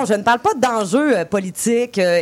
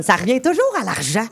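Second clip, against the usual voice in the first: normal talking voice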